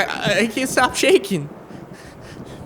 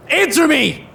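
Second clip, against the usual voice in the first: higher, louder furious yell; furious shout; 7 semitones higher, 4 dB louder, slower than usual. Acted. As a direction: explode at him. Man accuses rescuer of poisoning.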